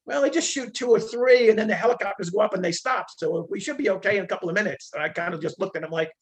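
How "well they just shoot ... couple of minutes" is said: mocking guard voice. Mimics UN guy's nonchalance.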